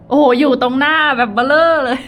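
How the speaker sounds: loud excited exclaim. Real, not acted. Spotting the huge pyramid out the window, loud and excited. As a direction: blurt it out, thrilled. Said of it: "อุ๊ย เสียงดัง".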